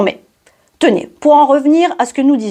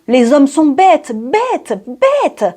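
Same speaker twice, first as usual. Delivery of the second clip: drawn-out lament, leaping high on the last "bêtes"; hammed-up exasperated wail; theatrical exasperation; 3 semitones higher, much slower than usual. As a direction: despair at human stupidity, slow and climbing. Exasperated refrain in comic monologue.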